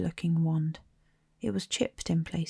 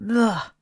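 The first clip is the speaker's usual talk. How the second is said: loud, higher ugh of disgust; 4 semitones higher, 8 dB louder than usual. A narrator's reading. Ron biting a sprout bean, loud disgusted ugh. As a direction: spit out the taste with a loud ugh.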